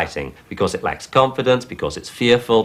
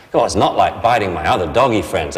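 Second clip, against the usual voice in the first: ordinary delivery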